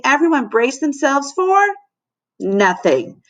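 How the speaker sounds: ordinary delivery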